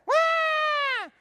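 high loud demon shout; demon shriek. Retold or imitated. Demon interrupting the preacher, shrieking loud. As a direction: screech like a possessed man. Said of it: "he goes".

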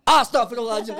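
a touch of a gasping pious mother; mother shocked by her son's question. Acted. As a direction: recoil in mock horror.

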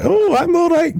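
very high Scottish wife voice; Scottish accent. Retold or imitated. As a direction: imitate the DM playing a Scottish wife. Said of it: "she's got a Scottish accent".

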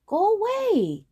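high, loud, slow shout. Acted. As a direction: yell it drawn out. Boy shouts at buffalo.

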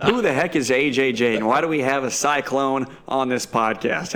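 normal talking voice

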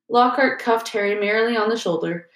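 plain reading voice

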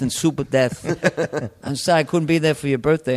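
brash New York father impression; apologetic movie-star dad, New York accent; New York actor dad. New York actor phoning his son on birthday, brash. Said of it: "a thing about a New York actor".

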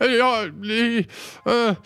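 put-on scared old man stumbling over his words; frightened stammer. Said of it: "Han ser väldigt förskräckt ut". (rädd, hög och stammande gubbe)

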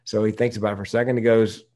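ordinary delivery

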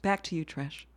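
understated, hushed throw to the co-anchor; mock anchor sign-off. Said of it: "I always wanna say".

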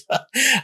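high, embarrassed chuckle; short sheepish laugh; nervous laugh. Real, not acted. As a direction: laugh at his own fear. Remembering being scared Sunday.

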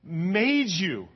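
high, loud, pleading divine voice; a jealous, loving God, preached at full force. Pretend. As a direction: speak as God calling his people back. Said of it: "He says".

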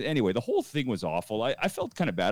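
ordinary talk

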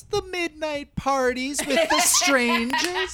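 put-on high, laughing kid voice; giggling through a silly answer; laughing mock child.

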